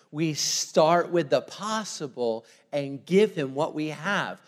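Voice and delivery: ordinary talk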